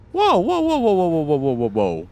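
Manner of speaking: high, loud alarmed whoas; startled whoa. Real, not acted. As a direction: yelp in surprise, then settle. Startled by a big enemy.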